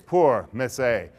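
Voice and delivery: milk the pity, slowly; mock pity. A narrator's reading. Narrator pities rejected heroine.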